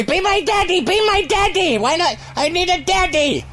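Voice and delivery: put-on high, pleading little boy voice; little orphan boy. Radio bit, orphan boy begging the host to adopt him. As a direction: beg like a small orphan. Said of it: "as the little orphan boy".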